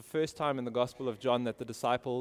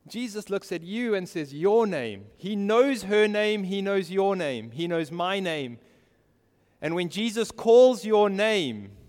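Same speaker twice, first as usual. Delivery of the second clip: raised, ringing pulpit voice; impassioned preaching; 6 semitones higher, 7 dB louder than usual. Real, not acted. Preacher telling listeners Jesus knows their name, impassioned. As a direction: drive each short phrase home.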